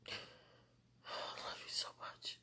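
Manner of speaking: very slow, barely audible whisper; hushed, lingering declaration; tender whisper. Real, not acted. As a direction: whisper it slowly, full of love. Tearful private declaration of love.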